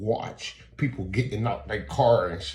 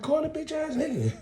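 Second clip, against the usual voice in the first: squealed, much higher dare; mock outburst, pitched way up; hammed-up high-pitched demand. Customer daring store clerk to call security, hammed-up outburst.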